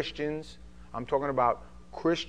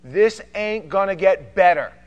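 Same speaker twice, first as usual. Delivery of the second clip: much louder, higher, pressing warning; urgent shouting warning; 7 semitones higher, 14 dB louder than usual. Retold or imitated. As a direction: hammer it home to save her. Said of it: "And I'll go".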